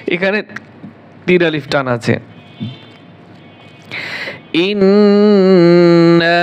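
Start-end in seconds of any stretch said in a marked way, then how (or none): 4.5-6.4 ইন্না drawn out slowly, louder and chanted; ইন্না recited. Shown as a demonstration. Teacher recites ইন্না slowly and loudly, drawn out. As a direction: stretch ইন্না long and full in recitation. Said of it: "হামজা নুন জবর আং গণ্য হবে".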